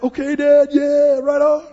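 put-on high, loud, eager children's voice; excited kids. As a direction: play the thrilled kids. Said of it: "the kids would have loved it".

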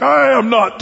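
hammed-up protest of innocence; Peter's outburst. Pastor retelling Peter's third denial, mock outburst.

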